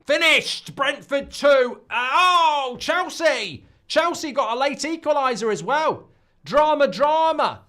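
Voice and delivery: high, loud, thrilled announcement; excited result shout. Real, not acted. Host announcing late drama at full time, excited shouting. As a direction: belt out the drama at full volume. Calling final whistle and late equaliser.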